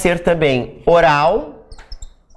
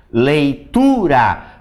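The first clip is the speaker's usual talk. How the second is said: Leitura said slowly and clearly; teacher repeating the word slowly; slow demo; much slower than usual. Shown as a demonstration. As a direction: model Leitura slowly again. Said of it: "por exemplo".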